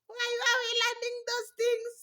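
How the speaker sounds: incredulous, half-laughing question; amused disbelief. Real, not acted.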